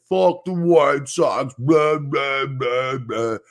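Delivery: loud slow jeering rant, mimicking a drunk fan; mock drunk fan. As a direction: ham up a drunk heckler shouting. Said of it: "spouting his shit".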